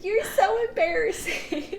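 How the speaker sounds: high mocking whine; bratty sister. Older sister scolding her brother, high mocking whine. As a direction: sneer it like a big sister. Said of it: "his older sister going".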